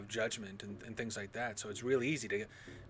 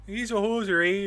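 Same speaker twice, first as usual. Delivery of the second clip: high, loud fake Canadian accent; Mackenzie brothers; 7 semitones higher, 7 dB louder than usual. Shown as a demonstration. Canadian showing the only accent he can fake, exaggerated Canadian accent. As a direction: lay on a hammy hoser Canadian accent. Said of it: "that Mackenzie brothers accent".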